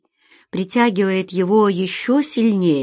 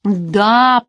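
loud, exasperated groan of a word; 3 semitones higher, 6 dB louder than usual. Acted. Alex, wiping his sweaty brow, answers Feride, groaning. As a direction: groan it out at the end of patience. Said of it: "простонал Алекс".

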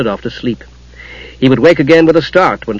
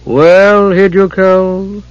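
higher, drawn-out, ominous address; dark goddess; 6 semitones higher, much slower than usual. A narrator's reading. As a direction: draw the words out with dark menace. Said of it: "voice seemed to embody darkness".

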